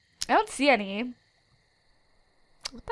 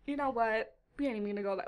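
quiet dismissal; 2 semitones lower, 10 dB quieter, much faster than usual. Real, not acted. Dropping the subject quietly.

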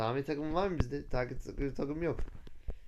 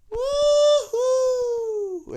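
long high joyful yell, much louder; excited whoop; 8 dB louder than usual. Real, not acted. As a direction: let out a big thrilled whoop. Speeding away from police. (coşkulu, yüksek sesli bir sevinç çığlığı)

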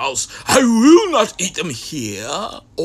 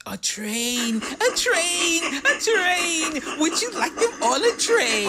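higher, thrilled offer; excited Sam; 9 semitones higher, 5 dB quieter than usual. Acted. Sam excitedly offering them on a train. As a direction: announce the train with delight.

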